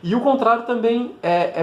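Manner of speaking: ordinary talk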